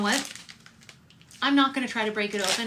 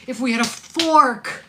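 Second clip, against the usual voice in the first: louder and slower, leaning on "fork"; emphatic loud repeat; 6 semitones higher, 10 dB louder, slower than usual. Real, not acted. Host repeats the viewer's joke as a loud punchline. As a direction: stretch out "If we had a fork" like a punchline.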